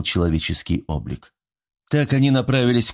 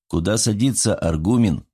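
plain reading voice

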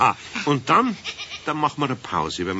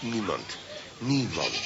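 quick, softer nobody; hushed denial; 4 semitones lower, 8 dB quieter, slower than usual. Acted. Eder hiding Pumuckl's fall from keeper, hushed denial. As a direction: play it down under your breath.

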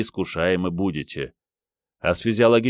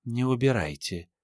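higher, gentle feline purr; 5 semitones higher, 4 dB quieter than usual. A narrator's reading. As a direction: coax him with a little purr. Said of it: "мурлыкнула прелестная мордочка".

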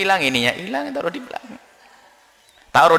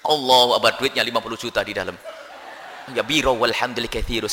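mock praying aside; 7 semitones lower, 7 dB quieter than usual. Acts praying while eyeing bag.

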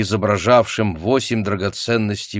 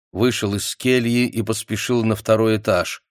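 ordinary delivery, nothing marked

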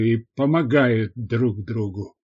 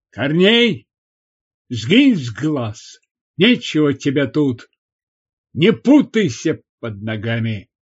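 bossy, angry woman sending them away; furious scolding; 3 dB louder than usual. A narrator's reading. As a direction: snap angrily with sharp rises on key words. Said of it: "злющая, как раненая в задницу рысь".

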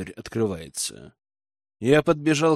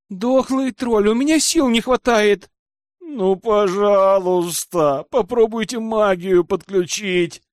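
higher-pitched wailing plea; plaintive whine; 6 semitones higher than usual. A narrator's reading. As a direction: whine like a witch at the end of her strength. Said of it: "жалобно ныла ведьма".

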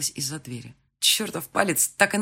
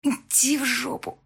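higher, near crying, slowed; tearful outburst; 9 semitones higher, slower than usual. Acted. Heroine crying out at her tormenting lover. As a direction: lash out through tears.

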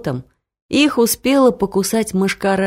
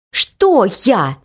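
higher, startled question; a mild narrated gasp of protest; gasped dismay; 4 semitones higher than usual. A narrator's reading. Лариска confronted by a big man, startled. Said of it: "охнула Лариска".

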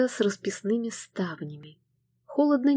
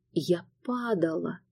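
usual speaking voice

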